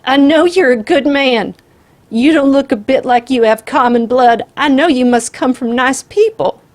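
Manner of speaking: put-on high, loud flattering old woman's voice; a frightened grandmother buttering up a killer; pleading old lady. A narrator's reading.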